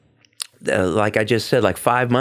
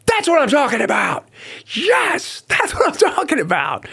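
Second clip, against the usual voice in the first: jubilant, much higher shout; excited shout. Retold or imitated. Jumping out of bed after the vision, an excited high shout. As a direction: yell it out of bed in pure joy. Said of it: "jumping out of bed going".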